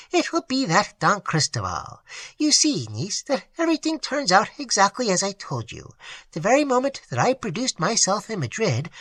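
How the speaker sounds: put-on vain old aunt. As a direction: flutter like a flattered old spinster.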